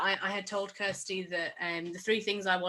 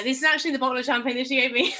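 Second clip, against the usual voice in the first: laughing; 5 semitones higher, 8 dB louder, much faster than usual. Real, not acted. Showing the gift bottle, laughing.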